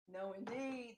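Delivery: very soft imitation of a refusing grandma; quiet echoed refusal. Granddaughter echoing grandma's refusal, very quietly. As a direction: repeat it far under the voice.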